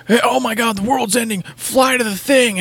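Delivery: high-pitched panicky game character; mock urgency. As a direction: send up a frantic end-of-the-world plea. Mocking other games' urgent plots.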